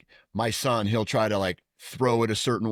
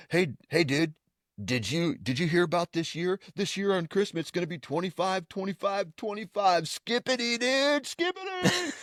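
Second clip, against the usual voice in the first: put-on excited kid; 6 semitones higher than usual. Kid telling his friends about Christmas, mock excited. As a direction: imitate a gullible kid, pitched up.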